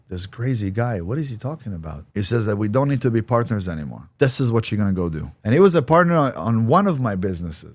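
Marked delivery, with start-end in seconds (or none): none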